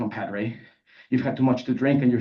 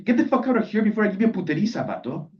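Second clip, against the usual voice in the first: angry threat; 9 semitones higher than usual. Acted. Alumnus threatening the narrator, angry. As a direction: threaten in a heated rush.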